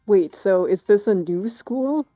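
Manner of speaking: ordinary talk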